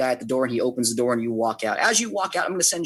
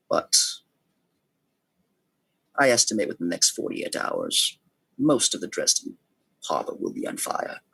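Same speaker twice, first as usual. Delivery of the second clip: deliberate schemer with long pauses; lightly voiced plotter, measured and unhurried; measured, ominous schemer. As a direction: issue a grave warning, phrase by phrase. Game master voices a scheming contact.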